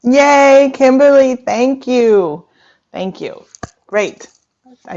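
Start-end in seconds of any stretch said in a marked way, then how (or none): 0.0-2.5 loud, high, drawn-out cheer easing down; big pitched-up thanks, very loud; excited cheer. Real, not acted. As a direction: cheer a guest with real delight. Cheers a guest's reply in chat.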